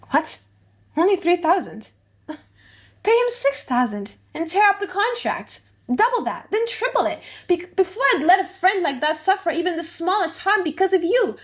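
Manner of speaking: high, amused disbelief, laughing it off; scoffing outburst. Acted. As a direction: brush the debt aside with a laugh. Portia scoffs at small debt.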